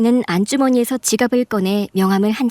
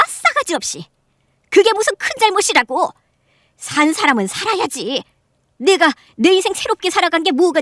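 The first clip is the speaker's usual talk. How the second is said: indignant aunt; 7 semitones higher than usual. Acted. Aunt defends herself shrilly.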